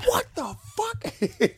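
slow, very high shocked squeal; a drawn-out high-pitched what-the-fuck; Aunt May's scandalized squeal at the window. Acted. Acting out Aunt May's reaction.

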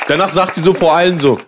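ordinary talk, nothing marked

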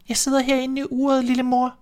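put-on very high, small kid voice; tiny hiding kid calling out; thin little voice. A narrator's reading. Youngest kid calling to mother from the clock, thin and high. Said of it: "en tynd lille stemme".

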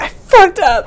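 high, loud wail of regret; sobbing cry. Acted. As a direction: break down crying out the words. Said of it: "burst into sobs".

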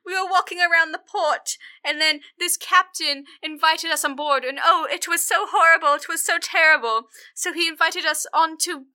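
high, loud theatrical sobbing lament; wailing distraught wife. Retold or imitated. Wife wailing to the Lord about the kidnapping, high and loud. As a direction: wail it out like a melodramatic noblewoman. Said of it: "and she's like".

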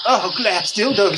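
high, slow, hammed-up sexy moan; mock sultry moan. Teasing cohost about masturbating, hammed-up slow sexy moan. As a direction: drag out the words like a cheesy porn moan. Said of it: "does it sound like this".

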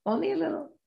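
high, soft and coy; tease, hold it back playfully. Shown as a demonstration. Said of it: "Keep the kimono closed".

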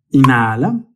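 drawn-out breathing cues, rising at each end; slow meditation guide, long pauses between cues; guided breathing. Acted. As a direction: lead slow, deep breaths, stretching each word. Leads slow breathing cycles.

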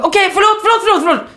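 rapid-fire high-pitched sorry; frantic apology. Retold or imitated. Passenger placating screaming driver, frantic apology. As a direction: blurt out apologies in a high, exasperated cry.

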